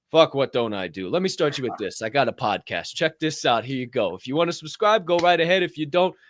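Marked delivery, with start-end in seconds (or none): none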